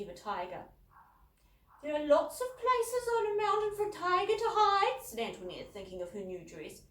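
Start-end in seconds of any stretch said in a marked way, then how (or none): 1.8-5.0 put-on high, loud, sulky posh girl; pouting teen. A narrator's reading. Elder sister sulking to Dad about missing Megan's weekend, high and pouting. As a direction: whine it with a pout.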